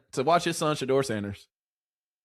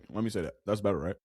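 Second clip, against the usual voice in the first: softer mock correction; 8 semitones lower, 9 dB quieter, faster than usual. Mock rephrasing of insult.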